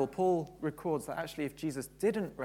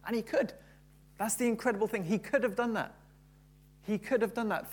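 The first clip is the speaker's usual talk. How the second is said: higher, louder preaching voice; impassioned preaching; 4 semitones higher, 3 dB louder than usual. Acted. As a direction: drive the point home to the congregation. Preaching at full force.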